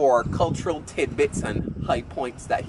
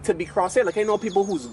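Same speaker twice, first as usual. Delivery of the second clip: ordinary talk, nothing marked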